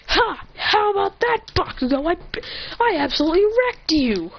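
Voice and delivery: high-pitched mock triumphant crowing; gloating taunt. Hero to rival Bakugo, high-pitched gloating. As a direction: crow over a beaten rival.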